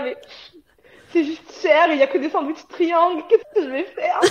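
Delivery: high, loud, laughing mock panic; laughing despair. Recounting her first-day shop to a friend, laughing mock panic. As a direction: laugh through the remembered shopping panic. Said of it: "j'étais là".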